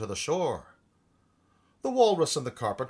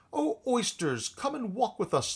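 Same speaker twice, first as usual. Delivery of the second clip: higher, coaxing plea to the oysters; beseeching walrus; 9 semitones higher than usual. A narrator's reading. Walrus begging the oysters to walk with him, pleading. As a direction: coax them sweetly to come along. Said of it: "the Walrus did beseech".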